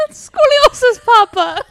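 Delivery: high, loud echo of the quote; character voice. Retold or imitated. Repeating movie line in voice.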